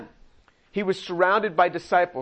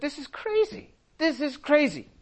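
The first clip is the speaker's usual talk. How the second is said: much higher, mock-panicked disbelief; hammed-up incredulous disciples; disciples' alarm; 9 semitones higher than usual. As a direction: squeal it like baffled followers. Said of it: "the disciples said".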